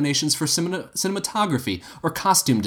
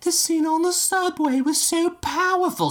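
high mocking impression of gushing women. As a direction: gush it in a sneering high voice. [name] mocking women's chatter.

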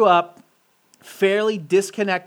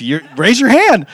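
teacher voice; 4 semitones higher, 10 dB louder, slower than usual. Shown as a demonstration. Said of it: "does anyone have a good teacher voice".

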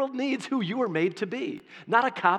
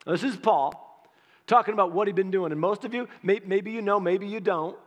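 usual speaking voice

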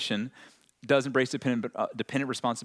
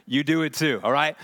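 playful call-out; 3 semitones higher, 6 dB louder than usual. Real, not acted. Calling out the audience.

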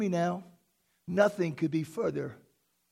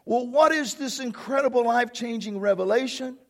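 usual speaking voice

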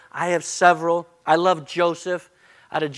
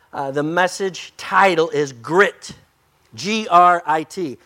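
ordinary talk

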